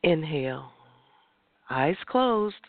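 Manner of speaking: guided breathing. Acted. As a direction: lead the breath gently. Leads breathing exercise.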